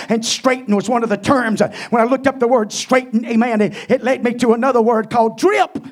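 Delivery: loud fervent sermon delivery; preaching. Real, not acted. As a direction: preach it out with fire. Preaching at full force.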